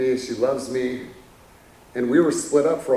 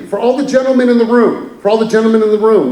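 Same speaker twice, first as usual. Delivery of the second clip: louder and higher, hammering the warning; raised mock-stern announcement; loud call out; 7 semitones higher, 6 dB louder, faster than usual. Real, not acted. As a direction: call out to every man in the room. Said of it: "let me make this very clear".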